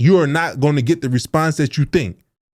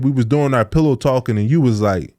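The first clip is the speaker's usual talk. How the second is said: ordinary talk, nothing marked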